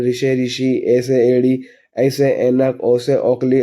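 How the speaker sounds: steady sing-song chant of the vowel chart; vowel chart recitation. Shown as a demonstration. Teacher chanting the Hindi vowels for pupils, in a steady sing-song drill. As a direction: recite each vowel and its word like a classroom drill.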